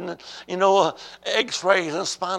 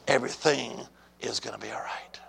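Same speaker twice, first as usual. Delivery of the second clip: low, soft, near-whispered promise; hushed reassurance; 7 semitones lower, 7 dB quieter than usual. Real, not acted. Preacher reassures troubled church, low and hushed. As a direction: bring it down low and quiet. Passing God's word to the church.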